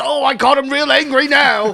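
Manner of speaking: lightly voiced thrilled wildlife host; Steve Irwin voice. Retelling Steve Irwin and the cobra, light impression. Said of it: "He goes".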